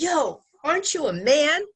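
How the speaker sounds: high, loud mocking taunt; hallway taunt. Jealous friend mocking in the hallway, loud taunt. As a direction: jeer across the hallway.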